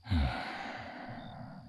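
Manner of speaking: long, soft sigh; heavy sigh. Acted. Dragon pondering how nature binds them, long sigh. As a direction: let out a long breath, softly.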